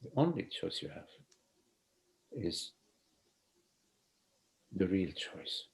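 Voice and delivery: hushed, unhurried guiding voice; soft spiritual teaching. Acted.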